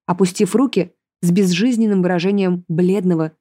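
ordinary delivery

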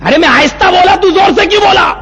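very high-pitched protest; imitated exasperated yell. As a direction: shoot the voice up in outrage. Accuser yells back in quarrel.

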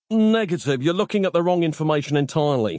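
ordinary delivery, nothing marked

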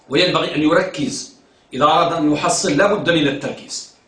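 ordinary talk, nothing marked

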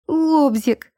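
high, urgent cry of one word. A narrator's reading. Woman clinging to the doorpost cries out, high and urgent. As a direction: call it out, pitched well up. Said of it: "выкрикнула она".

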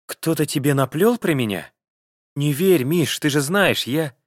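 high pleading woman's voice; plaintive wife begging her husband. A narrator's reading. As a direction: whine it like a wife caught lying. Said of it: "жалобным голосом сказала".

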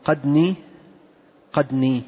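"قدني" as qadnee, d in place of ط; dal plus nun. Shown as a demonstration. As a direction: say "قدني" with a still dal, then long nee. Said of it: "بإبدال الطاء دالا وإضافة النون".

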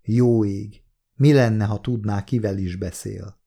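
usual speaking voice

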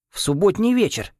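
excited high blurt. Acted. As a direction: burst out with a sudden idea. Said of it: "выпалил Дерри".

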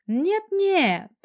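high, loud flustered protest; shocked gasp. A narrator's reading. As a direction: gasp it out in alarm, pitch leaping up. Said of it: "охнула она и". (испуганно охнув, высоко)

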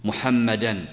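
محمدا with a falling -an ending, muhammadan; teacher stresses the accusative ending on محمدا, muhammadan. Shown as a demonstration. Teacher shows students the accusative muhammadan. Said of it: "وأحيانًا تقول".

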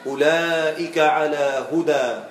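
slow measured recitation, "هدى" ending on a long aa; pausal alif in recitation. Shown as a demonstration. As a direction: stop on "هدى" without tanween. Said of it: "وهكذا تقرأ قوله تعالى".

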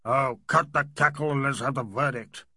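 loud, growling Major; gruff outburst of impatience; impatient growl. A narrator's reading. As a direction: growl it out loudly, cutting through the proceedings. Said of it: "the Major growled".

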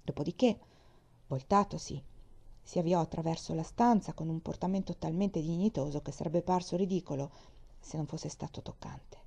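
usual speaking voice

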